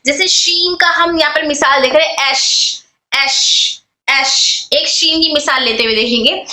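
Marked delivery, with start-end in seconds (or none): 2.3-4.7 "एश" ending in a long, spreading sh; airflow on sheen. Shown as a demonstration. Teacher models sheen for students, "एश" with flowing breath. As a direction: let air spread through the sh of "एश". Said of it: "शीन air flow के बगैर अदा नहीं होगा".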